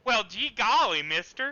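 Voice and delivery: exaggerated Southern accent; mock southern accent. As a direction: play a folksy Southern yokel. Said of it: "In my best northern accent".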